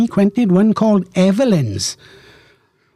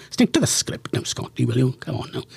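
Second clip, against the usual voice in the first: self-scolding aside; 5 semitones lower, 8 dB quieter than usual. Real, not acted. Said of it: "I don't know why I said that".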